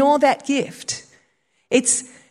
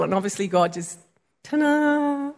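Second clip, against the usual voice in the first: ordinary talk, nothing marked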